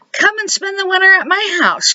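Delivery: high, eager invitation; eager cry. A narrator's reading. Ghost eagerly inviting the freezing mouse, high cry. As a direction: call out warmly, pitched high. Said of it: "cried Gus".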